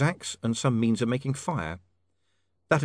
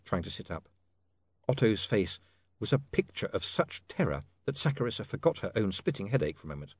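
ordinary delivery, nothing marked

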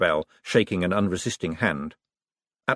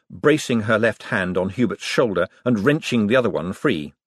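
ordinary delivery, nothing marked

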